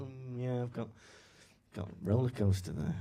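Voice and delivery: flat, bored shrug at the roller coaster; mock-indifferent local taking it for granted; unimpressed. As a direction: toss off "roller coaster" as nothing special, lightly imitated. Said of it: "you just take it for granted".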